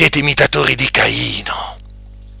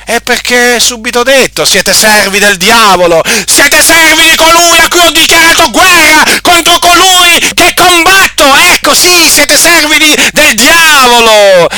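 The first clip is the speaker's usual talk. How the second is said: high, loud pulpit denunciation; fiery preaching; 13 semitones higher, 4 dB louder than usual. Acted. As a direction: thunder at them from the pulpit. Preacher denouncing servants of the devil.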